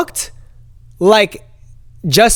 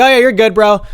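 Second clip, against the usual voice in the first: high, loud, casual bro voice; imitated easygoing guard; 6 semitones higher, 5 dB louder than usual. Security guard waving visitor through, breezy bro impression.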